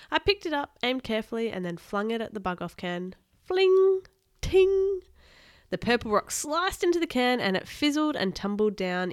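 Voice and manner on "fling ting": high, loud metallic pings; ringing sound effects. A narrator's reading. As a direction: sing out each ping far above the voice. Sounds of thrown rock hitting.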